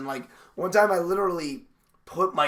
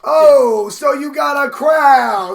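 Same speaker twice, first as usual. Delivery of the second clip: high, drawn-out New York shout; mock-impressed New Yorker hollering; New York accent; 11 semitones higher, 16 dB louder, much slower than usual. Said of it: "spoke with New York accents".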